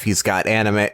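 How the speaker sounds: normal talking voice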